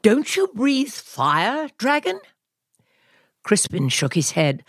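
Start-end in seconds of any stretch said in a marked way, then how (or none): 0.0-2.2 high knight voice. A narrator's reading. Knight asks the dragon why no fire, squeaky and puzzled. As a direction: ask it baffled and squeaky.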